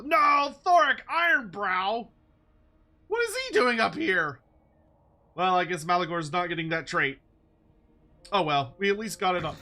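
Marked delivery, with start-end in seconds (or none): 0.0-4.4 shocked, rising outburst; surprised. Real, not acted. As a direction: caught off guard, exclaiming. Sudden unwelcome hero appears.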